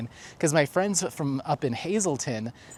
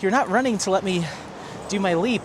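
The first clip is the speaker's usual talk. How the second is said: higher, playful dog voice; dog complaining to its owner about the leap, in a put-on higher voice; voicing the dog; 5 semitones higher than usual. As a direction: speak as the dog who wants to leap. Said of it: "He's like".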